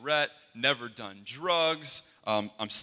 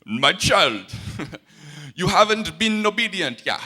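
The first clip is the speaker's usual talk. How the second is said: put-on "My child" in a louder Jamaican accent, half laughing, with the pitch rising on "obedient"; 6 semitones higher, 9 dB louder than usual. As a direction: play Miss Ruby in a Jamaican accent, lifting high through the line. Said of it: "but here's how, how it went".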